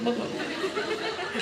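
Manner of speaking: stretched-out, slow laugh through the words; laughing plea. Real, not acted. Speaker laughing as he asks students to stop hitting, slow and laughing. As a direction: laugh while begging them to stop. Laughing while stopping a scuffle.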